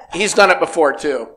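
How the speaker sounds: ordinary talk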